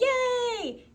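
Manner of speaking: high, drawn-out group cheer. Pretend. Teacher modelling the class's agreement, high cheer. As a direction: cheer as a pleased class would. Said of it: "then we'll say".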